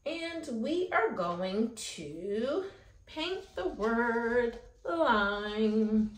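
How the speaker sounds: slowed down. Shown as a demonstration. Slow teaching narration.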